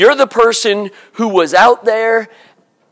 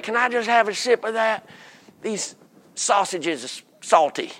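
hoarse, quiet plea of a thirsty worker, much softer; parched worker; 11 dB quieter than usual. Retold or imitated. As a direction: beg a sip, hoarse and quiet. Day worker begging a sip.

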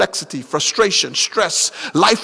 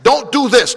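DON'T DO THIS high and loud, each word hit; emphatic; 7 semitones higher, 5 dB louder than usual. Acted. Preacher voicing Jesus forbidding something, emphatic "Don't do this". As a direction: hammer "Don't do this" as a firm command. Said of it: "When he's emphatically saying".

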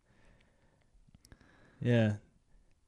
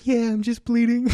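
high, whiny mock of himself; whiny wimp; 9 semitones higher than usual. Friend mocking how he was made to sound, high and whiny. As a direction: squeak it like a little wimp. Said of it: "You made me sound like a little bitch".

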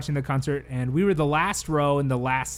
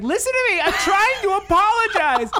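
acted yelled plea; 6 dB louder than usual. Drunk dancer insisting to his attacker, yelling. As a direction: re-enact desperately shouting to be heard.

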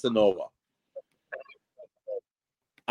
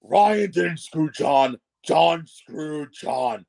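dramatic; 3 semitones higher, 3 dB louder, much slower than usual. Pretend. Mock dramatic pronouncement.

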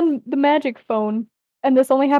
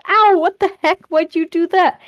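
high, louder yelp of protest; pained outrage; 5 semitones higher, 4 dB louder than usual. A narrator's reading. Cypher just got punched between the eyes, pained outrage. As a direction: protest after being hit.

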